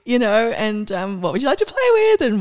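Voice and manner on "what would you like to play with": much higher, bright and inviting; generous universe offering. Pretend. The universe offering her possibilities, high and inviting. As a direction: hold out gifts like a shopkeeper. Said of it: "there's this energy of".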